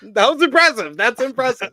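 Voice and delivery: high, loud mock amazement; overplayed praise; hammed-up awe. Real, not acted.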